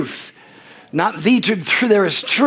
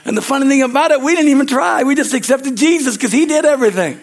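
fired-up pulpit preaching; preaching at full force; 5 semitones higher than usual. Acted. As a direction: preach it out to the congregation, building up. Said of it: "gonna preach today".

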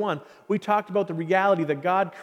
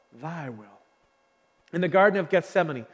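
ordinary talk, nothing marked

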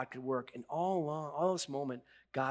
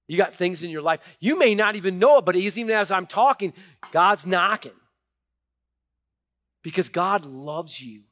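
loud, high preacher at full tilt; full-force preaching; 4 semitones higher, 11 dB louder than usual. Acted. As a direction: preach it hard to the whole church. Preacher rises again to congregation.

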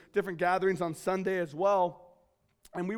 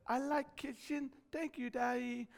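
put-on soft, higher little-girl voice; two-year-old daughter; 5 semitones higher, 9 dB quieter than usual. Toddler thanking daddy for her kitchen, small high voice. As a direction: imitate a sweet toddler, high and quiet. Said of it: "yesterday morning she says".

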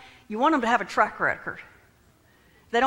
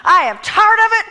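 high, loud mock rant; hammed-up fed-up outburst; retold rant; 11 semitones higher, 12 dB louder than usual. Preacher retelling a rant to her husband, loud and high.

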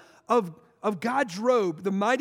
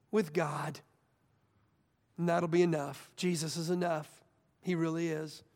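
hushed sincerity; 6 semitones lower, 5 dB quieter than usual. Real, not acted. Quiet sermon close.

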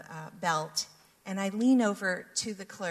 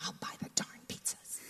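softer, half-whispered grumble; muttered offer; 8 dB quieter than usual. Retold or imitated. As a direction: grumble it under your breath. Man quoted gruffly.

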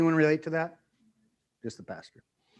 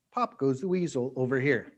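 ordinary delivery

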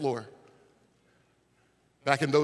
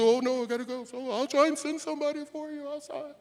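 higher, light excuse-making voice; assistant's voice; 12 semitones higher than usual. Retold or imitated. Assistant excusing himself to the man, mild higher voice. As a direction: brush him off in a put-on helper voice. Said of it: "He said".

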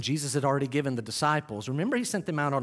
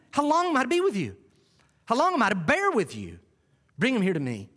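much higher, fast, fed-up cry; exasperated rebuke; 6 semitones higher than usual. Acted. Jesus scolding the faithless crowd, exasperated. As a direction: let Jesus vent his frustration. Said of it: "Who's Jesus fussing at".

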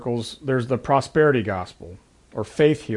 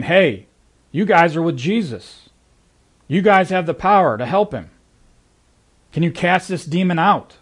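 pleading father; 5 semitones higher, 4 dB louder than usual. Retold or imitated. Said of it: "he goes up to the disciples ... saying".

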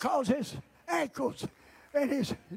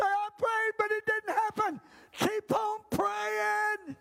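fiery preaching; 7 semitones higher, slower than usual. Acted. Preaching at full force.

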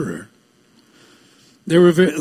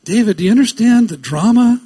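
raised, louder, insistent questions; urgent appeal; 6 semitones higher, 5 dB louder, faster than usual. Real, not acted. Preacher pressing David to grasp the drama, urgently.

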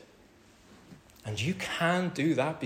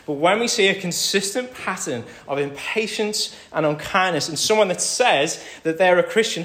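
louder, higher, building sermon cry; preaching at full force; 6 semitones higher, 10 dB louder than usual. Acted. Pastor urging the congregation, preaching at full force. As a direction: drive it home to the congregation.

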